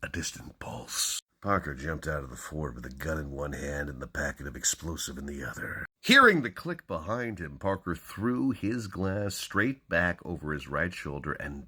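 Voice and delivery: dramatic hardboiled crime reading; hammy stage actor. Acted. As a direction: punch each opening, then hush it. Reads crime novel openings dramatically.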